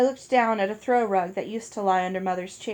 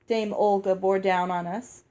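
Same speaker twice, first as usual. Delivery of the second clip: ordinary delivery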